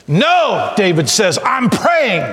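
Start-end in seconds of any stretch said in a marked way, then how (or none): 0.1-0.7 high, emphatic refusal; defiant cry. Acted. David answering men who hush his prayer, defiant cry. 1.4-2.2 high, emphatic refusal; defiant cry. Acted. David answering men who hush his prayer, defiant cry.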